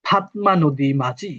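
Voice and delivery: ফাতমা নদী মাঝি slowly, in a British English accent; English teacher shows how the British say the title, in a British English accent. Shown as a demonstration. Teacher to students, showing the British way, slow British English accent. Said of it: "এটা হয় ব্রিটিশটা বলে".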